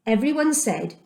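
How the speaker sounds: usual speaking voice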